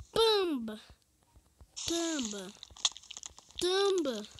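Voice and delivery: loud playful whack sounds; battle cries. Real, not acted. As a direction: shout each hit. Minecraft fight sound effects.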